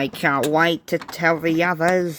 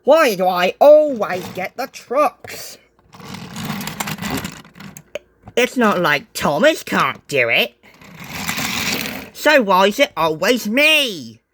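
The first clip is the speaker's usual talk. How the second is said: raging complaint; 6 semitones higher than usual. A narrator's reading. James complains about trucks, raging. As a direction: rant at the unfairness. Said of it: "in a fit of rage".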